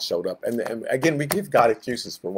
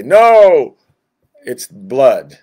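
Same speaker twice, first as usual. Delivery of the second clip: emphatic shout; 13 dB louder, much slower than usual. Acted. Preacher hitting the third excuse, loud. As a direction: slam it down, no, it's blood.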